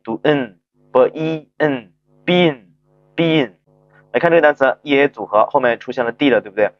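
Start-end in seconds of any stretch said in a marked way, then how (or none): 0.2-3.5 hummed N, then slow b-E-N into bin; sound-by-sound blending. Shown as a demonstration. Teacher explaining the silent-mouth N to learners, slow blending. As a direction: stretch each sound before joining them as bin. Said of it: "所以说呢要读哑巴音".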